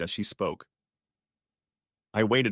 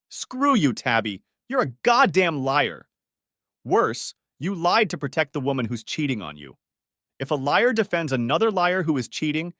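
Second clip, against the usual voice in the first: loud, higher furious yell; angry; 4 semitones higher, 3 dB louder than usual. Acted. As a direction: lash out in anger. Furious outburst at Tabby.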